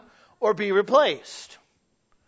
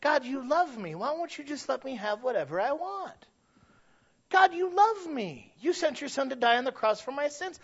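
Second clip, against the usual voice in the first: higher, petulant plea to God; mock childish pleading, pitched higher; whiny believer; 4 semitones higher, 5 dB quieter than usual. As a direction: whine to God like a spoiled child. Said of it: "our relationship with God".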